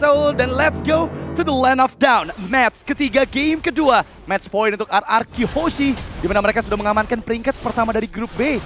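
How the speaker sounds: ordinary talk, nothing marked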